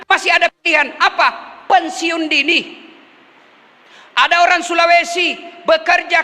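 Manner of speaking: high-pitched, driving sermon delivery; emphatic pulpit oratory; fervent preaching. Real, not acted.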